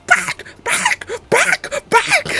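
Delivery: very high squeaky little-dog bark; yappy dog voice. Retold or imitated. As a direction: yap it like a tiny angry dog. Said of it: "And you just".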